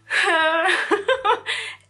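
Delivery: high burst of laughter; loud high laugh. Real, not acted. Laughs at pink bottles.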